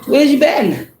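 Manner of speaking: high, fake-innocent mocking question; mock sudden interest. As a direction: imitate the caller who vanished and now returns. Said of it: "mocking returning people".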